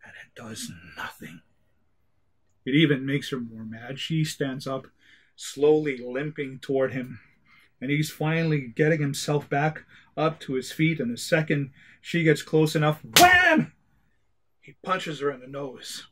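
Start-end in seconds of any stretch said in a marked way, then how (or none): 0.0-1.4 whispered deadpan aside. A narrator's reading. Narrator whispers that the computer did nothing. 13.2-13.7 loud, high "wham!" sound effect; punch sound effect. Acted. Narrator acts out the punch, a loud high wham.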